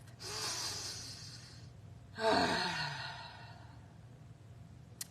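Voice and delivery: long audible sigh out; long exhale. Shown as a demonstration. Showing the group a deep breath, long audible exhale. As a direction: let the breath out slowly and fully. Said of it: "Deep breath".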